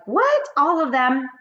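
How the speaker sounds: high shocked witch squeal; startled witch. A narrator's reading. Witch in story voiced.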